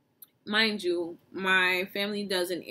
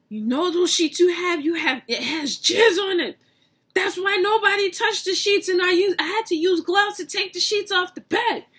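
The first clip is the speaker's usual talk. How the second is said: high, loud outraged telling; aunt blurting it out, loud and high; aunt's loud exposé; 10 semitones higher, 8 dB louder than usual. Retold or imitated. Aunt telling grandma about the sheets, loud and high.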